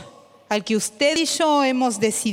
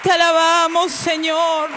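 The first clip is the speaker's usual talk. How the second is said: fervent worship prayer; 5 semitones higher than usual. Acted. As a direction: cry out praise with full force. Leads worship prayer with music.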